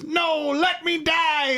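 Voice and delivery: high, slow, hammed-up alien plea; dramatic plea. Podcast host mocks T'Char begging to die, high hammed plea. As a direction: ham up a dying alien begging to be let go.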